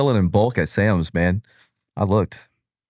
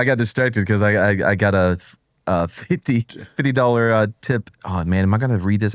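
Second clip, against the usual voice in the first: ordinary delivery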